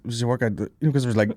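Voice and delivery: ordinary delivery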